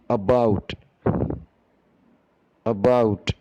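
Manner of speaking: 'about' said clearly, twice; model pronunciation. Shown as a demonstration. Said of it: "I will be pronouncing each and every word".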